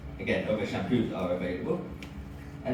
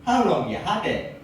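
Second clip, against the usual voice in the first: deadpan question; 6 semitones higher, 4 dB louder than usual. Retold or imitated. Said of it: "dead serious and goes".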